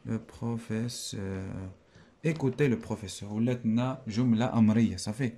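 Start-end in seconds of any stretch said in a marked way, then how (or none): 0.1-1.7 very slow, softly drawn-out syllables; soft, stretched self-dictation; murmured dictation. Shown as a demonstration. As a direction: sound it out quietly while writing. Teacher sounding out words while writing.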